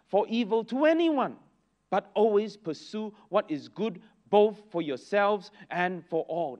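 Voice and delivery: slow, loud, solemn reading from the pulpit; a preacher reading the Bible to the congregation; scripture reading. Acted. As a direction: proclaim each line of the verse deliberately. Reads scripture aloud.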